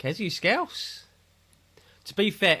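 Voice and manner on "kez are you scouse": slow, higher mock Scouse accent. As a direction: echo the question in a put-on Scouse accent. Mimics question in put-on voice.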